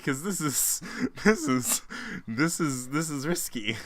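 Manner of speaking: laughing nervously through the words; giggling, unsure of the joke. Real, not acted. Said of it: "it's nervous laughter".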